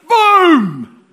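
big shouted high boom; loud boom. Acted. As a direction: slam the word down like thunder.

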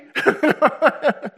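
a burst of high laughter; high loud laugh. Real, not acted. Laughing at his own shock, high and loud.

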